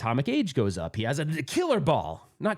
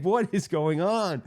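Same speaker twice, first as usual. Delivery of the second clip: slow, higher-pitched disbelief; retold exasperation; 4 semitones higher, slower than usual. Player retelling his bafflement after the ball took off, exasperated. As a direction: stretch it out, exasperated.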